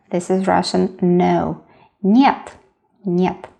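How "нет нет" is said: normal talking voice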